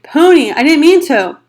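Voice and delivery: loud, high scream after him; desperate scream. A narrator's reading. Darry screaming after his runaway brother, loud. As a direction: call him back in panic. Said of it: "Darry screamed".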